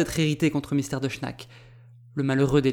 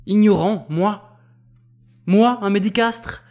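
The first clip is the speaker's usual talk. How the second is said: higher, louder indignant questions; outraged retort; 5 semitones higher, 6 dB louder, slower than usual. A narrator's reading. Insulted doctor throws his rival's words back, outraged.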